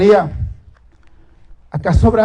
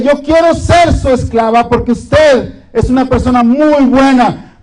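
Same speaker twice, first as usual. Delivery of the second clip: higher, slowed, tearful pleading; llorando; 6 semitones higher, slower than usual. Acted. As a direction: beg him, a touch of tears in the voice. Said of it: "llega corriendo la mujer llorando y dice". (súplica llorosa, más aguda y lenta)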